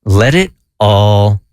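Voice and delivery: slow, spaced-out voice in the head, falling low on each phrase; lightly voiced inner voice, measured and slow; inner voice speaking. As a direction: let each phrase drop low, with long pauses between. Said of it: "I hear a voice saying".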